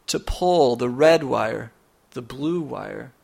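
normal talking voice

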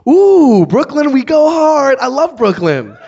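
high, very loud whooping cheer; mock overexcitement. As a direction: whoop like an overeager hometown booster. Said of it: "She was excited".

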